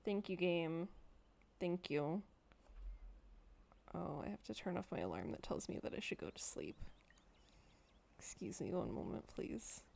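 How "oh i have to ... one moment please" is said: whispered, hushed apology; a streamer whispering an apology off to the side; whispered aside. Real, not acted. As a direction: slip it in under your breath. Whispering aside to turn off alarm.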